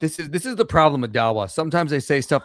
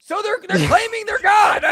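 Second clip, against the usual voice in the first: high, loud laugh-shout of disbelief; 5 dB louder than usual. Real, not acted. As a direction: laugh it out, can't believe it. Laughing disbelief at opponent's claim.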